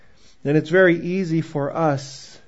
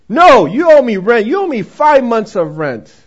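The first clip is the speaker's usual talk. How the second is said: higher, loud, harsh insistence; old landlord rejecting her answer, loud; angry insistence; 6 semitones higher, 11 dB louder than usual. Retold or imitated. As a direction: shout him down.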